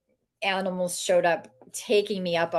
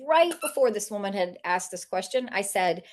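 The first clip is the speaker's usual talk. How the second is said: ordinary talk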